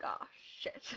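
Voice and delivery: whispered private curse; inner voice. Retold or imitated. Retelling her silent dread at the request, whispered. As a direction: whisper it as a secret thought. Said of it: "And inside".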